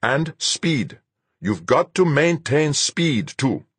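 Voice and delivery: put-on high, slow, insistent accented addition; insistent instructor. A narrator's reading. Fuchs adds a point about speed, insistent. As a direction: stress each point to the pilot. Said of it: "Then he added".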